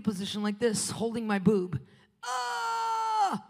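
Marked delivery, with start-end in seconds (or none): 2.2-3.4 high pained cry; retold pain cry. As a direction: yelp as if it hurts. Pain in the night.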